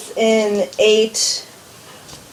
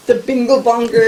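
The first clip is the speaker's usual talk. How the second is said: laughing. Real, not acted. Teasing misnamed ship, laughing.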